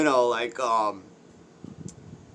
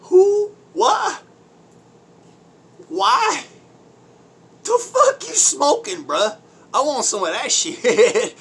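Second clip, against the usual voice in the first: much higher and louder, laughing disbelief; incredulous mock yell; 13 semitones higher, 8 dB louder than usual. Reacting to people taking blame for history, laughing incredulous shout. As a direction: yell it like you can't believe your ears. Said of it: "And I'm like".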